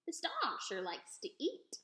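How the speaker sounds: slow, lightly voiced old woman; chuckling old lady, amused; a slow old woman amused by the dog; old woman's voice. A narrator's reading.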